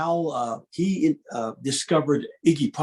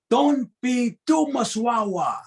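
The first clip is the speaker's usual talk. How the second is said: put-on slow, high Tongan-accented dad voice; 7 semitones higher, slower than usual. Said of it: "I'll just say it in his accent".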